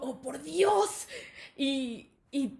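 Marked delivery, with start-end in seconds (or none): 0.0-1.1 drawn-out, higher gasp of disbelief; laughing mock horror; shocked classmates. Class watching the argument, stretched mock shock. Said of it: "Y todos de que".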